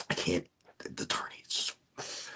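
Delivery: breathless pained whisper; barely voiced, hurting; strained whisper. Real, not acted. Strained whisper after pain.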